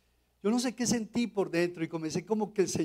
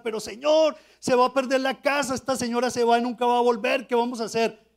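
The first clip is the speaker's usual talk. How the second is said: higher and louder, pleading in a rush; anxious pleading; 6 semitones higher, 7 dB louder than usual. Retold or imitated. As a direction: beg the Lord, fretting over the lost house.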